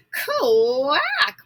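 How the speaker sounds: a high, loud, falling quack; startled duck quack. A narrator's reading. Missus Mallard tumbling back from the road, loud high quack. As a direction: squawk it like a frightened duck. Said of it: "went Missus Mallard as she tumbled back".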